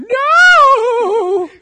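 huge high drawn-out scream; anguished mock wail. Dumped boyfriend crying out, hammed-up anguish.

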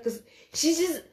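normal talking voice

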